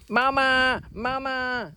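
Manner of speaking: high, plaintive mama, mama; child calling his lost dog; imitating a crying boy. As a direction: wail the name like an upset kid. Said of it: "Dan was crying and going".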